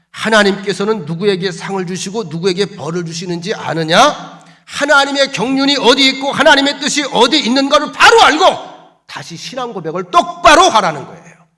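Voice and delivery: loud, high exhortation building in force; forceful preaching. Acted. Preacher demands a renewed confession of faith, exhorting. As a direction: drive the demand home to the congregation.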